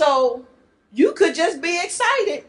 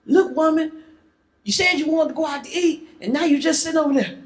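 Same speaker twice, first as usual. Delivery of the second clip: quick, higher-pitched exasperation; exasperated; 6 semitones higher, faster than usual. Acted. Husband scolds silent date at dinner, exasperated. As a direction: complain fast, fed up.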